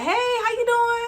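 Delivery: high-pitched cheery hello; bright high greeting. Retold or imitated. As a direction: greet her sky-high and bubbly.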